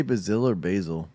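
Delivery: "Brazil" as "buh-ZILL", "basil" as "BAY-zil"; contrasting pronunciations. Shown as a demonstration. Streamer asking what the cook said, contrasting "buh-ZILL" and "BAY-zil" in "Brazil or basil". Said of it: "He said that funny".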